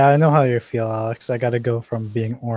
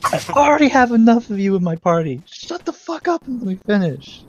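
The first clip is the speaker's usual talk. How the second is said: high, raised voice telling someone off; cross yelling; 8 semitones higher, 5 dB louder than usual. Acted. Character scolding a party member who interrupts, cross yelling. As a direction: snap crossly at an interrupting companion. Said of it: "Why are you yelling at me?".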